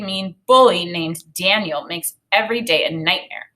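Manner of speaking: ordinary talk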